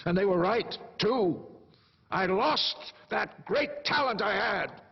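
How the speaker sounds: high, loud, bitter regret; impassioned, self-accusing tirade; anguished outburst. Acted. Anguished regret over ruined career.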